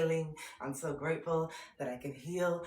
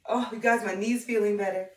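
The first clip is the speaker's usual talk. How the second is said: louder, higher happy news; excited cheer; 4 semitones higher, 7 dB louder than usual. Real, not acted. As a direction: cheer it out to viewers.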